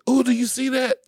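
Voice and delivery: high, loud imitation of a startled partner; mock-startled high-pitched cry; startled girlfriend. Retelling girlfriend's startled reaction.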